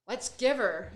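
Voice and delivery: mock pep talk. Mock Wednesday morning pep.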